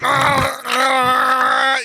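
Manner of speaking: loud, high scream; mock screaming toilet. Toilet screaming while being peed on, loud high scream. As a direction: scream like you're being waterboarded. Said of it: "It's like".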